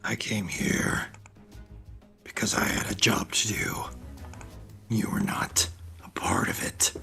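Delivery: sad whisper. Acted. Player voices Grom to dying friend.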